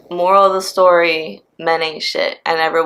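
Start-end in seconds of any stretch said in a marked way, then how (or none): none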